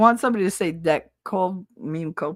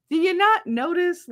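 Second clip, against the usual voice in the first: incredulous; 12 semitones higher than usual. Retold or imitated. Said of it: "I'm like".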